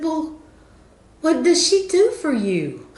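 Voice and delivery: high-pitched puzzled question, imitating her; puzzled lady. Retold lady keeps asking.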